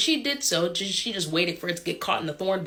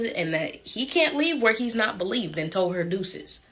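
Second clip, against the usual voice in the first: ordinary delivery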